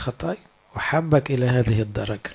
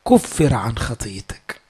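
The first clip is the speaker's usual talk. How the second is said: slow, lower, solemn angel pronouncing; angel's voice; 4 semitones lower, slower than usual. Acted. A slow, lower angel absolving Isaiah's sin. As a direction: lay each word down like a verdict.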